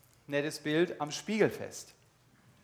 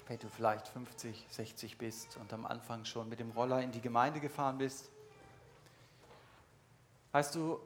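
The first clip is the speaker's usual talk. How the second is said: softer, lower, confiding sermon voice; quiet earnest appeal; 5 semitones lower, 8 dB quieter than usual. Acted. Preacher quietly confronts longtime churchgoers, softer and lower. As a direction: lean in and speak softly to the listener's conscience.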